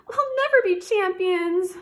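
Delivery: put-on a small girl giving up; sighing princess. A narrator's reading. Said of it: "Pinecone sighed".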